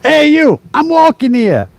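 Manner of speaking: high, punchy New York-Boston street voice; mock Boston accent. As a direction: belt it out like a Boston wiseguy. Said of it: "they have a punch to their voice".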